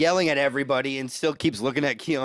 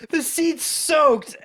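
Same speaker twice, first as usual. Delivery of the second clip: whiny complaint; 12 semitones higher, much slower than usual. Retold or imitated. Retelling Kiona's bus-seat complaint.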